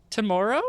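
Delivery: "tomorrow" with a rising, puzzled end; questioned Canadian "tomorrow". Retold or imitated. Host retelling her puzzled reply, "tomorrow" rising at the end. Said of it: "You say it funny".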